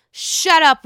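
loud, exasperated snap; fed-up shut up. Real, not acted. Telling Whitney to shut up, loud and fed up.

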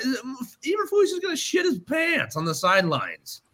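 high, excited call-out; excited shout. Real, not acted. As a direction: shout it with glee. Excited prediction about coach.

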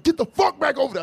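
high barked threat; imitating an angry gunman; mock angry yell. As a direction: play the gunman snapping at him.

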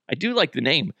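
usual speaking voice

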